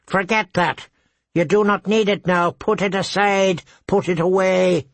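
a hint of a bird commanding the boy; lightly voiced crow, urgent. A narrator's reading. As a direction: crow urging Bran to push the memory away, lightly high. Crow urging Bran to forget.

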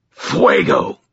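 high, forceful snarled incantation; snarled spell. A narrator's reading. Wizard casting fire at the fence, snarled. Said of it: "and snarled".

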